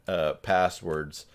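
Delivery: ordinary delivery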